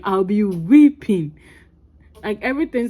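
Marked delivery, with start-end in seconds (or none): 0.0-1.4 weeping drawn out slow and loud; "weeping" stretched and punched louder; stress on weeping. Real, not acted. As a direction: lean hard on "weeping" to set it apart from crying. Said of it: "Not crying".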